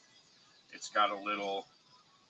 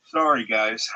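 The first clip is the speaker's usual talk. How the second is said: usual speaking voice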